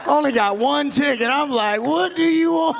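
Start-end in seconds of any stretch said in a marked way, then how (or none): 1.8-2.7 high, acted holler; pitched-up mock yell; mock challenge. Acted quote, raised challenge.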